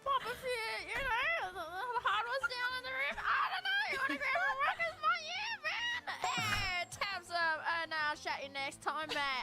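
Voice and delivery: hammed-up Aussie mate chatting away; exaggerated Australian accent. Contestant showing off in an accent challenge, exaggerated Australian accent. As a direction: put on a broad, overdone Australian accent. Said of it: "Do your best Australian accent".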